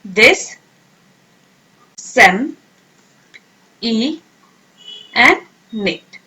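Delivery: disseminate split into दिस, सेम, ई, नेट with pauses; syllable by syllable. Shown as a demonstration. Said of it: "words को तोड़ तोड़ के pronounce करें".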